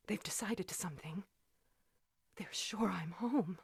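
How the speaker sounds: hushed, breathless whisper; frightened whisper. Acted. Woman hiding from callers whispers in fear. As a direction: whisper so no one hears.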